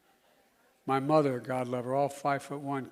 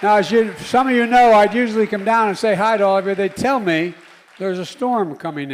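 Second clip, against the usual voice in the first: higher and louder, driving call to action; rally speech at full force; 7 semitones higher, 15 dB louder than usual. Real, not acted. President urging young crowd that Congress must act, shouting. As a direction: rouse the crowd to demand action.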